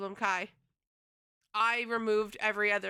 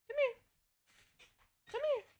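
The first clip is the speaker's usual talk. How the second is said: much higher coaxing call; high cat call; 13 semitones higher, faster than usual. Real, not acted. Streamer coaxing her cat onto her lap, high pitched. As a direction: coax the cat over in a high voice.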